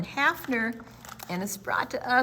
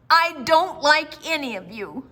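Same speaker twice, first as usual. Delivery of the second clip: put-on loud, higher sulky child voice; 7 semitones higher, 9 dB louder than usual. A narrator's reading. Lucille sulkily telling her leaving family she doesn't like them. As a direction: spit it out like a pouting little girl.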